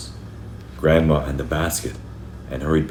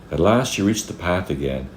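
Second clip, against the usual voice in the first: ordinary delivery, nothing marked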